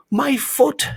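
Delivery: loud, very high-pitched bee; shout. A narrator's reading. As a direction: cry out high in outrage. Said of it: "shouted the bee".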